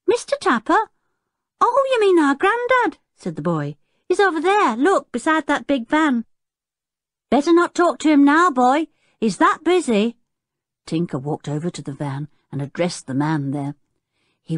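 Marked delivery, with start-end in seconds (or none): 0.1-3.0 put-on very high child's voice; high, chirpy circus kid; small boy. A narrator's reading. Small circus boy answering Tinker. 4.1-10.2 put-on high child's voice; high-pitched, eager circus kid; small boy. A narrator's reading. Small circus boy giving directions.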